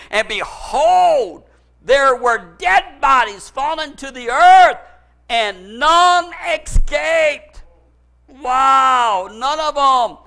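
loud, slow, drawn-out scripture proclamation; preaching at full force. Real, not acted. Preacher reading of the enemy's defeat, full-force preaching. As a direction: thunder the verse out, stretching the key words.

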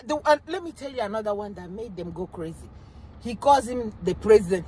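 ordinary talk

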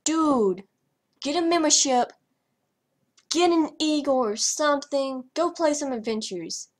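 mocking the non-member bunnies begging for stuff; mock beggar. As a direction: imitate whiny beggars, lightly hammed.

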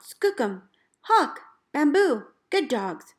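calling out. Retold or imitated. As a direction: call the team by name across the snow. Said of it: "I call out".

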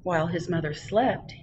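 plain reading voice

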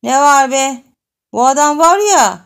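loud, high irritated retort; annoyed boy yelling back; annoyed snap. Acted. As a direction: bark back at her, fed up. Annoyed boy answering the girl.

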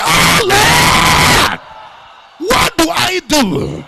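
loud, slow, drawn-out desperate cry; crowd-rousing shout; shouted prayer. Shown as a demonstration. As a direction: wail it out to the Lord. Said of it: "Can you shout it again".

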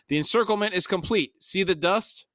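high, urgent cry; high-pitched character exclamation; Pormqual. A narrator's reading. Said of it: "Pormqual cried".

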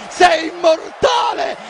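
ordinary talk

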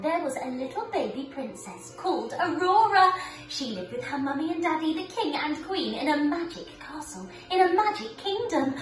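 ordinary talk

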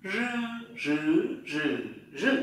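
"Rư" buzzed with tongue tip curled, through four Mandarin tones; curled-tongue voiced r. Shown as a demonstration. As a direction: curl up and voice each "rư", changing tone. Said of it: "cong lưỡi dưới thanh dung". (đọc "rư" cong lưỡi, rung dây thanh)

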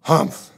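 high, loud scornful humph; loud grumpy humph. A narrator's reading. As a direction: snort it out like a sulky camel. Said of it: "Camel said".

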